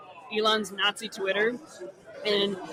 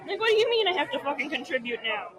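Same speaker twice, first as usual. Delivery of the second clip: indignant housewife; 5 semitones higher than usual. Retold or imitated. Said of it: "a nineteen seventies housewife".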